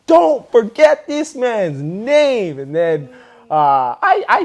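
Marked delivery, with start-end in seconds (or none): 0.1-2.6 proud declaration. Retold or imitated. Cheering his dancer's win.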